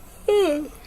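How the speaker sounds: long weary sigh; heavy yawn-like sigh; tired sigh. Real, not acted. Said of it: "Sorry, guys".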